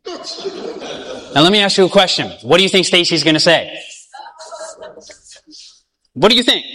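normal talking voice

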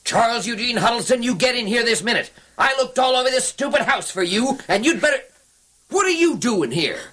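angry. Acted. Angry mother calling son inside.

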